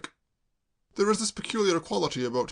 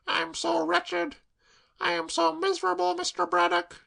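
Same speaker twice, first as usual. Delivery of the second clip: put-on tearful girl. Claire sobbing to Braddock, high tearful wail.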